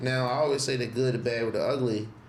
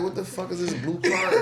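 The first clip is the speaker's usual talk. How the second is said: higher-pitched, laughing mock bewilderment; retold bafflement; 5 semitones higher than usual. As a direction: play up the puzzlement, amused. Retells bafflement at the blue card.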